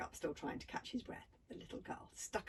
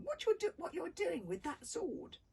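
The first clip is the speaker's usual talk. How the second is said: indignant; 10 semitones higher, 5 dB louder than usual. A narrator's reading.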